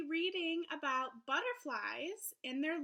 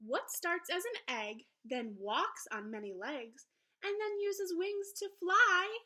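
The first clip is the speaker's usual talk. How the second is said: lively, sing-song picture book read-aloud; reading to children; 4 semitones higher, 5 dB louder, slower than usual. Acted. Teacher reading a butterfly book to her class, lively storybook voice. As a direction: read it brightly to young listeners.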